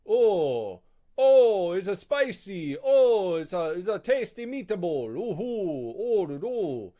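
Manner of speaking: loud, high, hammed-up Italian chef; mock Italian accent, shouting with delight; Italian guy impression. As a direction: gush over the food like a cartoon Italian. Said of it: "It's like an Italian guy".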